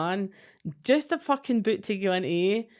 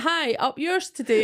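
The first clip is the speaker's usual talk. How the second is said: mock garbled subtitle voice, a touch higher; mock subtitle misreading; 6 semitones higher, 4 dB louder than usual. Mimicking the subtitles that mangle Scottish speech, mock voice. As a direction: read it like a broken subtitle. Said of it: "subtitles are fucking mental".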